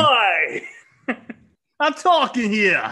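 slow, mock New York heckle; New York accent. Cohost joins the bit in a mock New York accent. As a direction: play a street guy cutting in. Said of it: "That was great".

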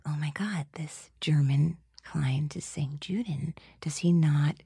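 whispered panic. Retold or imitated. Panicked inner thought.

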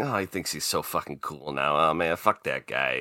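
low, soft grumble under the breath; muttered scorn, dropped low and soft; imitated muttering. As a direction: grumble quietly like a disgruntled fan. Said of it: "start muttering".

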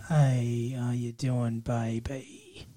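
a soft, low mock come-on from Spike; imitating Spike. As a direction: croon it like a sleazy charmer, half-hearted. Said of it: "It's a weird, like".

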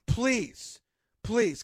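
normal talking voice

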